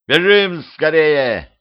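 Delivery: loud, high, stretched call; shouting. Acted. Petrovich urges vampire girl to run, shouting. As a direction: cry out urgently, drawing out the words. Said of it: "крикнул Петрович".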